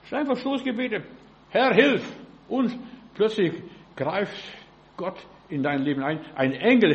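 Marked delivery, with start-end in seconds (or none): 1.5-2.0 raised, louder demonstration of a call; calling for help. Shown as a demonstration. Preacher models an emergency prayer, calling for help. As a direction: call up to God briefly and urgently.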